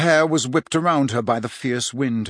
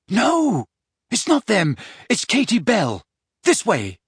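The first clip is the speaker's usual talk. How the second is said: urgent; 7 semitones higher than usual. Acted. Harry urgent reply.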